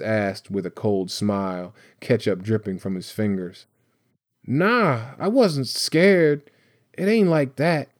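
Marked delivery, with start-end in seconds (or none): 4.5-7.9 put-on high-pitched, defensive boy's outburst; defensive blurt. A narrator's reading. Boy denying he was scared, blurting defensively. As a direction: blurt it out in protest, pitched well up. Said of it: "blurted, his voice louder than he had meant".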